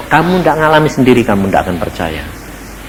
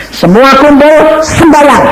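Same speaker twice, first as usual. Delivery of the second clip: higher, slow, louder commanding call; God commanding everyone to gather and pray; divine command; 5 dB louder, slower than usual. Retold or imitated. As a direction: proclaim it as God's order. God's command voiced.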